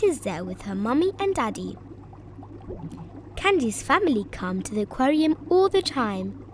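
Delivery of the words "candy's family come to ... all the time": ordinary delivery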